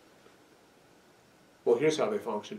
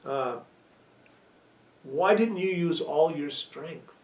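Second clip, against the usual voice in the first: gentle father; 5 semitones higher, slower than usual. Retold or imitated. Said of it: "the father says to the son".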